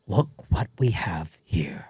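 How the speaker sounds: slow, low whispered Joker villain; Joker-style villain. Pretend. A Joker-style villain greeting his victim in a slow whisper. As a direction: breathe it out like a creepy Joker. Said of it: "Joker-style characters".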